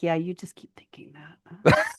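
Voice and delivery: whispered, menacing aside; sly whisper. Retold or imitated. Will Wheaton to Sheldon, sly whisper. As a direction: let him in on it under your breath.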